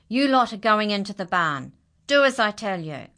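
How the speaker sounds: angry order. Acted. Farmer ordering animals into the barn, angry. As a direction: drive them into the barn.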